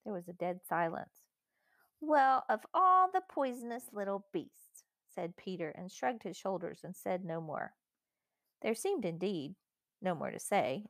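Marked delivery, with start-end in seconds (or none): 2.0-4.8 slow, high-pitched outrage; scandalised boy condemning someone, voice raised in pitch; indignant rebuke. A narrator's reading. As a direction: draw out the disgusted accusation. Peter rebukes Edmund for lying.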